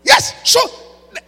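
loud, high, forceful preaching; preaching shout. Real, not acted. Preacher shouting at listeners about love and anger, loud. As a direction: drive the point home at full volume. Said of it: "I'm preaching".

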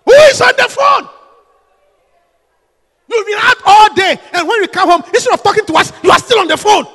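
loud, high furious shout; wife shouting at husband who ignores family; angry shouting wife. Acted. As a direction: yell at him for being on the phone.

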